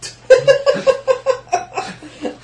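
high, loud burst of laughter; real cackling laugh at a friend's joke. Real, not acted. Laughing at the dying player's line.

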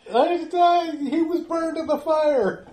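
high, loud scared stammer; frightened blurt. Acted. Villager at knifepoint blurts the answer in fright.

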